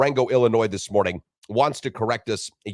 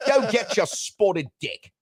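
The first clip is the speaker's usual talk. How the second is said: high, slow hammed-up English voice; mock British accent; 10 semitones higher, slower than usual. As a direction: put on a silly British accent to tease. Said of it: "I just think that's fun to say".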